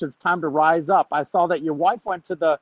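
ordinary talk

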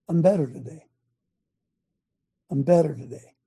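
ordinary talk

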